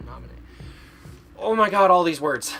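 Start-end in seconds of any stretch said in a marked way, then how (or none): 1.4-2.5 loud, higher-pitched exasperated outburst. Real, not acted. Struggling with hard names, exasperated wail. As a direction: wail it, overwhelmed, tipping into laughter.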